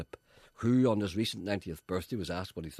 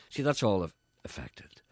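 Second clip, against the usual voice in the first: usual speaking voice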